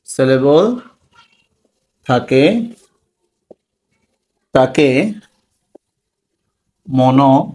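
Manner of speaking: one word at a time, long gaps; dictation. Real, not acted. Teacher giving a definition for notes, dictating. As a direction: dictate slowly, pausing after each word.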